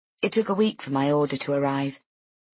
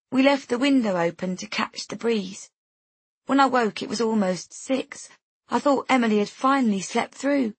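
higher-pitched, restrained grieving mother; a bereaved mother's appeal, pitched up; grief-stripped voice; 5 semitones higher than usual. A narrator's reading. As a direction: plead with worn, understated grief. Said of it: "her voice stripped bare and scoured by grief".

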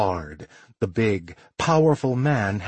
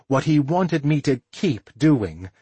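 plain reading voice

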